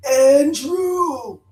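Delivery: high, loud dog-howl voice; howled name. Retold or imitated. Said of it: "just like that".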